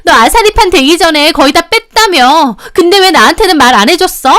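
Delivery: high, loud furious accusation; enraged. A narrator's reading. Youngest sister-in-law grabbing the elder's hair, enraged. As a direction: scream the accusation while attacking. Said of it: "눈이 확 뒤집히더니".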